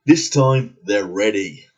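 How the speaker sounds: low, dramatic movie-tagline read; mock trailer voice. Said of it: "It says right here on the cover".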